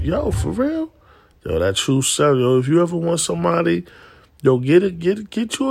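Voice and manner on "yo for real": shocked surprise. Retold or imitated. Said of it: "I'm like".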